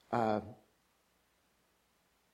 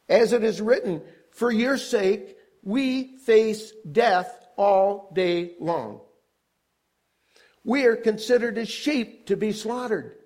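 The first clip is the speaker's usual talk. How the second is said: higher, slow, emphatic declamation; impassioned scripture preaching; 8 semitones higher, 5 dB louder than usual. Real, not acted. Preacher reciting trials believers face to the congregation, impassioned. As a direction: declaim each hardship deliberately, with weighty pauses.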